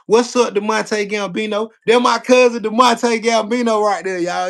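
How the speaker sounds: shouting out a viewer on stream; loud hype shout. Real, not acted. Hyping up a chatter loudly.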